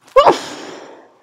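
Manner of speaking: sharp high breathy gasp; high pre-sneeze gasp. Real, not acted. Sneeze building up.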